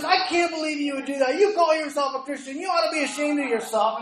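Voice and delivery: high, loud mock scolding; imitated yelling. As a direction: berate someone at full tilt, acted out. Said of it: "yelling at her".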